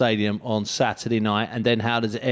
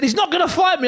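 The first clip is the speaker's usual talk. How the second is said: high, raised frantic quote; frantic shout. Retold or imitated. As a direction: mimic a frantic raving boxer. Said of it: "you were shouting, you were raving".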